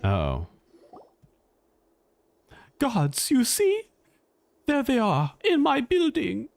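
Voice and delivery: normal talking voice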